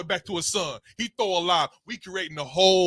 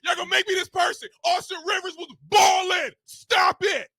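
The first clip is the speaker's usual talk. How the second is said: heated yelling; 10 semitones higher than usual. Real, not acted. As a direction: yell it, fired up. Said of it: "[name] done lost his voice and got all emotional".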